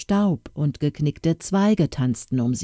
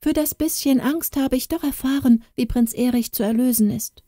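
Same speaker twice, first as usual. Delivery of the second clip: put-on high girlish voice, hopeful; young Katrin, relieved; 6 semitones higher than usual. Katrin thinking to herself about saving the prince, hopeful high voice. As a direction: voice a brave girl who has just learned the secret.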